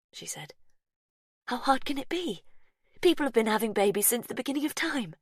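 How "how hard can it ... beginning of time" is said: higher, fast, nervous pep talk; talking herself into courage, quickly; brisk, anxious reassurance; tense Verity. A narrator's reading.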